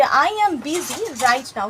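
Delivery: very slow, word by word; teacher slowing English for students; slow model sentence. Shown as a demonstration. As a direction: spell it out slowly for learners. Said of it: "কিভাবে বলবে".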